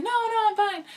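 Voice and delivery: very high, protesting denial; acted insistence. Insists she can ride.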